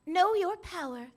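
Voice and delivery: inspirational character line. Acted. Performing a character's motivational line.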